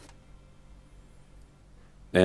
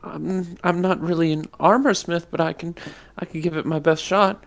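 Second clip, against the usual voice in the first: pixie; 9 semitones higher, 4 dB quieter than usual. Acted. Shopkeeper voice.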